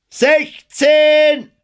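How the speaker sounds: very high, loud depth call. A narrator's reading. Lookout calls the depth from the bow, high shout. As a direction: sing out the sounding at a shout. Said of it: "rief die Stimme vom Bug".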